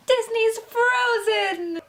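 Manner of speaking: high, drawn-out cheering shout; excited shout. Real, not acted. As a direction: announce it like a prize reveal, slowly. Big reveal of the movie.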